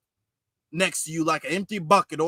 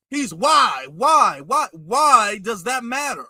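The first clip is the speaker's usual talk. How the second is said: exasperated demand; slower than usual. Real, not acted. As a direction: hammer each "why" in exasperation. Exasperated challenge to the clip.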